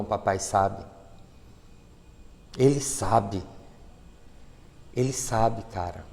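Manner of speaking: soft low "papai sabe", fading to near silence; hushed insistence. Acted. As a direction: lean in and drop to a murmur. Preacher lowering voice intimately.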